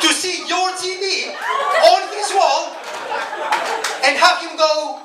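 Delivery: high, slow, laughing near-shout; laughing outrage. Real, not acted. Comic telling crowd about stolen TV, laughing high shout. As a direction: stretch it out in disbelief, laughing.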